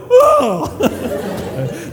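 very high, drawn-out whoa; a little kid caught red-handed; boy startled to hear dad behind him; kid's startled whoa. Retold or imitated. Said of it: "little five-year-old go".

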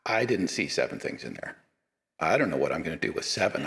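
ordinary talk

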